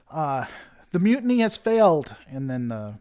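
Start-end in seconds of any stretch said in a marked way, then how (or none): 0.9-2.1 urgent distress call. Acted. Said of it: "She's like".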